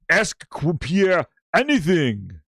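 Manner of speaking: slow mock Arnold accent; drawn-out Arnold-style imitation; Pierre. Host mocking Pierre's question session, slow Arnold accent. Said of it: "He talks like Arnold".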